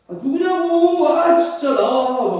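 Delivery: high, loud, stretched-out protest; protesting yell. Acted. Lost child pushes away unknown mother, protesting yell. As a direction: yell back in mock outrage, dragging the words. Acted child rejecting the stranger.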